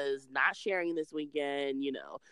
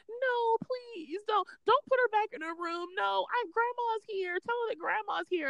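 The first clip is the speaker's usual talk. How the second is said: pleading grandma. Retold or imitated. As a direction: beg like a doting grandma, much higher. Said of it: "my mom is like".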